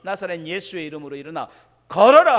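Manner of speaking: confident command ending in a loud shout on 걸어라; bold proclamation. Acted. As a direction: proclaim it with full authority. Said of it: "당당하게 말하는 거예요".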